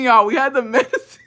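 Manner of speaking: high, laughing through the words; giggling at the memory; laughing while telling. Real, not acted. Admitting they had medicine, laughing to friends. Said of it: "I was fucking laughing".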